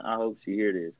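normal talking voice

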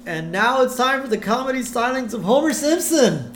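high, showy stage introduction; show announcer. Acted. MC bringing a comic on stage, big announcer voice. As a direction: introduce the act like a peppy MC.

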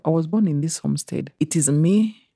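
ordinary delivery

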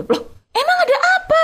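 very high-pitched startled question; acted alarmed bewilderment. Interviewer answering the accusing caller, acted high-pitched bewilderment. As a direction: reenact being taken aback, pitch leaping up.

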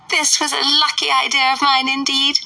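high, fluttery mother's voice; fussy Mrs Bennet. A narrator's reading. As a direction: play the indignant silly mother.

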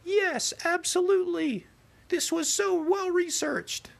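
very high, slow falsetto gushing; mock gushing commenters. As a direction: ham up the fawning commenters. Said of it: "people who were like".

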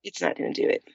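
a near-whisper promise that all is well; hushed reassurance. Real, not acted. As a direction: murmur it to calm a scared friend. Calming her scared friend.

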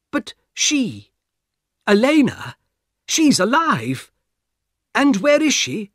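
a frightened passenger begging for help; urgent Mister Harris. Acted. Harris disbelieving, frantic.

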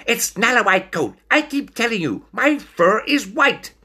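high, loud indignant protest; snapping stoat. A narrator's reading. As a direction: snap back in a high little stoat voice. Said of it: "Stoat snapped".

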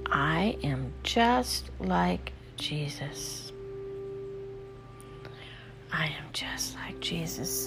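slow whispered affirmation. Shown as a demonstration. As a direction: breathe the words out in a whisper.

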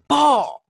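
a sharp high shouted "po" like an impact; loud mock bang. Retelling the stone hitting the house, a loud mock bang. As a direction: smack it out like a rock hitting wood.

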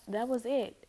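ordinary talk

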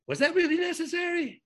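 high-pitched, put-upon question; mock reproach. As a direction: retell it as exaggerated reproach. Retold rebuke to honking driver.

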